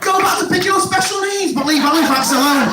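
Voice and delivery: high, hammed-up protest; mock indignation. Outraged local defending Halifax from the comic, mock indignation.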